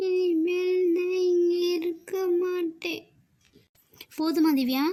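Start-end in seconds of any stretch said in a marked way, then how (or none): 0.1-3.0 put-on slow, higher child's voice; a small kid sadly announcing she is leaving; little granddaughter apologizing to grandma, slow and sulky; sulky little girl. Little girl leaving after quarrel.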